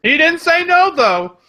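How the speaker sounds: high, loud, triumphant crowing; high-pitched gloating yell; gleeful teasing shout. Real, not acted. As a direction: crow it loudly to rub it in.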